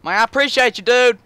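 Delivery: very high, loud thrilled yelling; excited shouting. Real, not acted. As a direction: crow over the new truck. Thrilled over the new truck.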